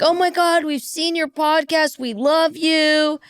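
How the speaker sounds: mock squeal of adoration, words stretched out; gushing fans. Two regulars greeting podcasters at the bar, gushing.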